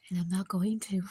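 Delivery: breathy under-the-breath whisper. Real, not acted. Whispering that she won't tell her husband. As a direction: let it slip out as a secret whisper. Keeping car news from husband.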